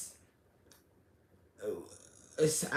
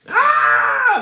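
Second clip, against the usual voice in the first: loud high frustrated scream; 15 dB louder than usual. Real, not acted. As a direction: let out the pent-up work stress. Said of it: "that's what I wanna say".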